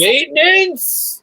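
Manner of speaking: high, drawn-out character call; shouting. Acted. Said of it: "my bonus action is just me shouting".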